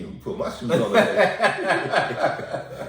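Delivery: high laughing line. Real, not acted. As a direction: laugh through it. Laughing remark.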